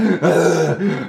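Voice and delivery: big hammed-up gasps; acted heavy breathing. Runner cooling down on the table, exaggerated heavy gasping. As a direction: heave for air after an all-out run. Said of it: "breathing super heavy".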